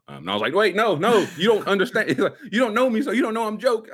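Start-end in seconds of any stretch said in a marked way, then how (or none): 0.5-2.1 high, loud backpedalling; retold flustered pleading; frantic protest. Retells his own frantic explaining. 2.5-3.9 high, loud self-justifying; retold frantic explanation, pitched up; flustered pleading. Retells frantic attempt to explain joke.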